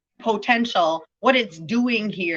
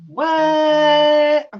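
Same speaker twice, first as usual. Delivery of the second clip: high, loud drawn-out what; hammed-up disbelief; mock astonishment; 8 semitones higher, 8 dB louder than usual.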